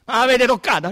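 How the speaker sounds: the inspector presses his men, loudly; insistent loud question. Acted. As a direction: push the question hard. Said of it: "Domandò".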